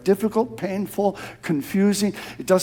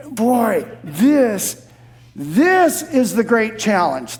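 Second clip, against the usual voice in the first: ordinary delivery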